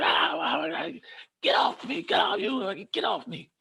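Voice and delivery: high, strained cries to be let go; high frantic protest; panicked yelling. Retold or imitated. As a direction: squeal get off me while pinned. Pinned down, yelling at staff.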